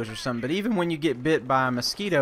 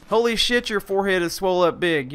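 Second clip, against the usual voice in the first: higher, startled mock sergeant outburst; alarmed sergeant; 5 semitones higher than usual. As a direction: act out the sergeant's shock. Sergeant freaking out again.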